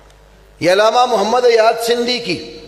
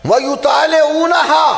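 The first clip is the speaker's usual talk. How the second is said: declaimed Arabic; 6 semitones higher, slower than usual. Real, not acted. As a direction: ring it out over the congregation. Preacher declaiming Arabic quote.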